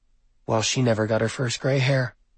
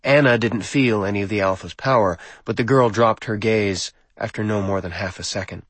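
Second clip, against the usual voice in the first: usual speaking voice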